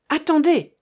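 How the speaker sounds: high, loud halting call; urgent cry. A narrator's reading. Sailor halting talk of shooting the captive, urgent cry. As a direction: cry out to stop them at once. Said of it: "s'écria Marcof".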